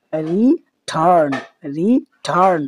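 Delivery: slow, syllable-split return, weight on turn; stress on second syllable. Shown as a demonstration. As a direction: draw out return and press hard on its turn. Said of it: "টি এর মধ্যে উচ্চারণটা কিন্তু অনেকটা stress করতে হচ্ছে".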